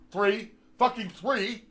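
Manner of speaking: slow, punching "three, fucking three"; slow mocking stress on "three". Real, not acted. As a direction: drag out "three" in disbelief. Stressing the small number.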